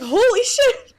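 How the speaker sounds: hammed-up shock; mock astonishment. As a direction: blurt it out stunned. Remembered reaction, exclaiming.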